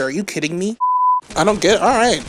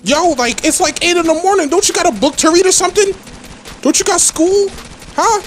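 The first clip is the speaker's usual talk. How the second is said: high-pitched, incredulous complaint; rising, aggrieved questioning; exasperated; 9 semitones higher than usual. Real, not acted. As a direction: rant at pesky players, voice raised high. Exasperated rant at other players.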